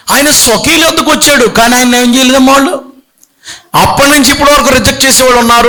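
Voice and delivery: high, fervent preaching, building; rising preaching fervor. Real, not acted. (ఉత్సాహంగా ఉన్నత స్వరంలో బోధ)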